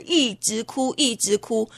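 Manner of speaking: plain reading voice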